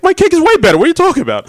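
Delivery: indignant protest. Retold or imitated. Quoting indignant contestant.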